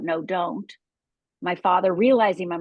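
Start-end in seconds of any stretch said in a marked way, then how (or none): none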